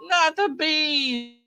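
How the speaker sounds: high, slow, hammed-up wail of despair; drawn-out mock anguished shriek; mock horror-movie cry. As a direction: ham it up like a campy movie freakout. Joking movie quote shout.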